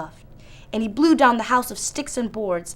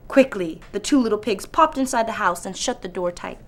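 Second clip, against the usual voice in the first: ordinary delivery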